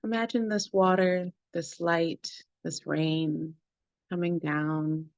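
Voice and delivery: unhurried meditation guide with long pauses between phrases; guided meditation. Acted. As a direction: lead the group gently through the body, phrase by phrase.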